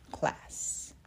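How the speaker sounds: plain reading voice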